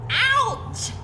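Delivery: sharp high ouch; a sudden pained yelp; high startled cry of pain. Acted. Porcupine yelps in pain.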